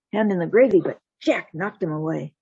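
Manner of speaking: ordinary delivery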